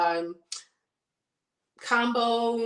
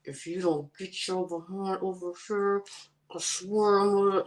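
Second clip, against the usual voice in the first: hushed, tight-jawed mom warning; through clenched teeth; 4 dB quieter than usual. Shown as a demonstration. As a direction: threaten your child without moving your mouth. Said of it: "talk to him through your teeth without moving your mouth".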